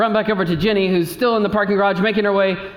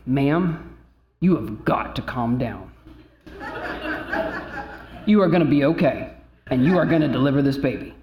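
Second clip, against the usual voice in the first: firm calming; 5 semitones lower, 3 dB quieter than usual. Retold or imitated. Calming a panicking doctor firmly.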